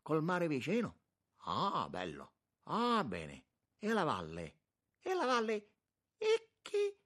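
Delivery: loud, high-pitched exclamations; excitable churchman marvelling loudly; effusive monsignor, delighted. A narrator's reading. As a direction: gush at each new sight, pitch leaping up. Monsignor exclaims over the view.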